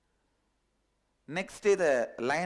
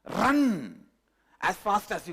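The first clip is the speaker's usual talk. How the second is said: a sudden loud cry of run; urgent command; 3 semitones higher, 4 dB louder than usual. Retold or imitated. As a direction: shout the word to flee. Urging to flee.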